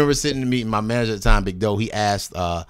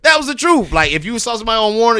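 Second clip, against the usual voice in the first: emphatic; 10 semitones higher, 5 dB louder, faster than usual. Real, not acted. Heated point about Warner rap.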